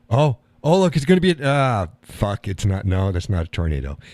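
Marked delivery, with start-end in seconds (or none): 0.1-1.9 higher, louder rush of excitement; a storm chaser spotting a funnel; sudden excitement. Retold or imitated. Excited as a tornado seems to form.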